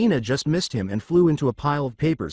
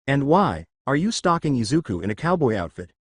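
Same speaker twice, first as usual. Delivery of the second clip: usual speaking voice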